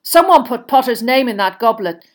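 loud, emphatic explanation; pointed and forceful; gruff Moody figure, knowing. Acted. Moody spelling out the plot to Karkaroff, knowingly.

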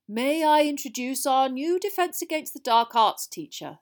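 high, loud headmaster's introduction; bright announcement. A narrator's reading. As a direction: present the newcomer brightly to a silent hall. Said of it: "said Dumbledore brightly into the silence".

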